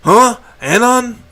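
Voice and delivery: high startled huh; surprised. A narrator's reading. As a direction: caught off guard, puzzled. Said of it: "wide-eyed blink".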